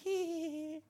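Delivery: high, soft squealed giggle; imitated little-girl giggle. Imitates the little girl's response.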